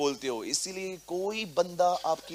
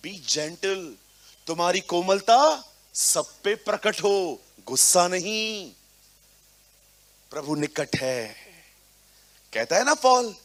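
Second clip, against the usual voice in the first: normal talking voice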